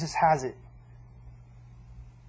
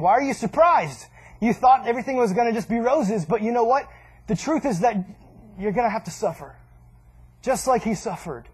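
louder, higher pleading rebuke; Peter, raised; 4 semitones higher, 4 dB louder than usual. Acted. Preacher voicing Peter, louder and higher. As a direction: raise it as Peter scolding the surprised. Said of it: "he's saying".

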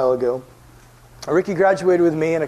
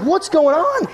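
very high, loud, incredulous question; amazed; 13 semitones higher, 5 dB louder, slower than usual. Retold or imitated. Visitor watching their ritual dance thing, amazed bewilderment. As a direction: cry it out in acted astonishment.

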